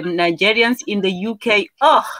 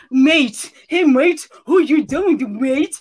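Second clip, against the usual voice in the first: put-on British accent, pitched up a little; mock UK accent; 4 semitones higher, slower than usual. Mocking Nigerians eager for a UK accent, put-on British. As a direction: imitate a would-be Brit's UK accent. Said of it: "to get the U K accent".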